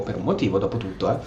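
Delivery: ordinary delivery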